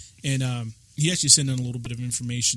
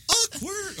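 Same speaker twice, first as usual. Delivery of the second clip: very high sing-out of "Awkward"; Family Guy awkward. Acted. Host joking after an uncomfortable moment, high cartoony "Awkward". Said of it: "That was very good Family Guy".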